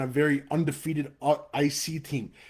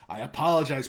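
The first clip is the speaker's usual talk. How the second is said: ordinary talk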